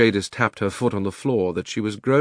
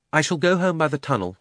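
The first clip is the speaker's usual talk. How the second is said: cold; 7 semitones higher than usual. A narrator's reading. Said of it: "rather coldly".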